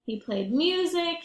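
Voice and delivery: ordinary delivery, nothing marked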